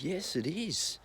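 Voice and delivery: mock insistent stepmom. Stepmom at dinner insisting, in a prim high voice. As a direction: snap back primly, voice up high. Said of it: "She goes".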